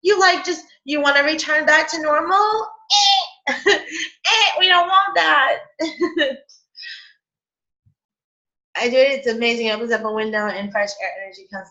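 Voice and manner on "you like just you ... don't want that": high, playful scoff breaking into laughter; mock disbelief. Energy rejecting a return to normal, laughing mock disbelief. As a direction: reject it with a high, comic buzzer noise.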